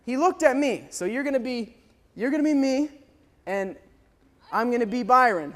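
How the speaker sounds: ordinary talk, nothing marked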